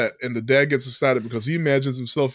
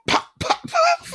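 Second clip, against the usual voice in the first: mock smack noises; slower than usual. Imitating beating sounds.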